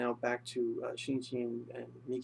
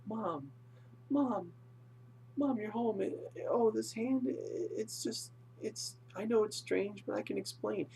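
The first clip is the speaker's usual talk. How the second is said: high-pitched flustered son pleading with mother; mock-distressed, raised-pitch stammering; Shinichi; 10 semitones higher than usual. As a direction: act out a boy desperately explaining himself. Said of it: "Shinichi's just like".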